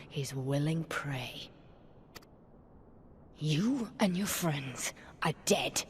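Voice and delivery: whispered taunt, quickening on misery; venomous whisper. Acted. Prisoner to the rebel leader, venomous whisper. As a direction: hiss her doom at her quietly.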